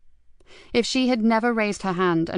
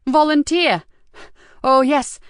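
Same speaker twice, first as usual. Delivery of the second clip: higher and louder, bitter mock cry; scornful outburst; 8 semitones higher, 6 dB louder, slower than usual. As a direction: spit out volunteer, high and loud. Nikki scoffs at the volunteer.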